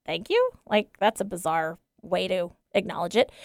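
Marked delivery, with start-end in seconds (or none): 0.0-0.5 mock proud thanks. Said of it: "I was like".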